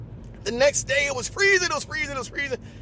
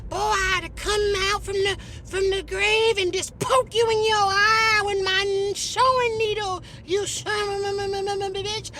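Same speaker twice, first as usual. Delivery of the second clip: Rosa Parks voice, higher and slow, scolding; slow scolding old lady, higher pitched; 5 semitones higher, slower than usual. Retold or imitated. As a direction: play a stern old woman dressing him down. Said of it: "She said".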